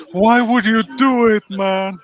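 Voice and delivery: very high, very loud comic holler; mock heckling yell. Joking along about park proposals, mock heckling yell. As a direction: wail it out in mock disbelief.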